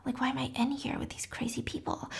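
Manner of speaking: unvoiced whisper to herself; hushed whispered complaint; whispered aside. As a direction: whisper it under your breath in disbelief. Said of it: "She's like".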